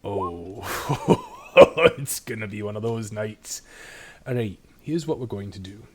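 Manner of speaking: slow it down like a careful whiskey ritual; conspiratorial dwarf. Acted.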